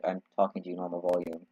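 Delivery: soft, low, matter-of-fact reply; retold normal volume. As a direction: imitate someone calmly insisting they aren't yelling. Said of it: "and people are like".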